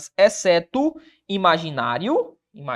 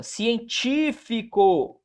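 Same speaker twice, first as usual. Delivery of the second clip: "Científico" very slow, with TÍ stretched out; "Científico" drawn out around a strong TÍ; stress on antepenultimate syllable; 3 semitones higher, much slower than usual. Shown as a demonstration. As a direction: sound out "Científico", leaning hard on TÍ. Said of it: "proparoxítona".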